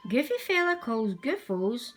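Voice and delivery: plain reading voice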